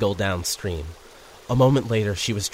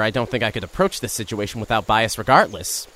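ordinary talk

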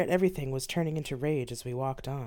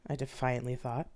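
ordinary delivery

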